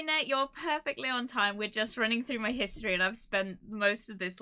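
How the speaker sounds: ordinary delivery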